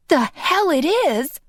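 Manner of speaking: high, outraged retort; incensed denial, pitched high; indignant. Acted. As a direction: throw it back at him. Furious at Phil breaking in.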